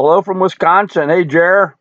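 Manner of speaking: ordinary delivery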